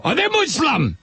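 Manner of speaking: high-pitched, agitated mock German; movie German accent. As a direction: burst out as a film German interrogator. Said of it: "would consider a German accent".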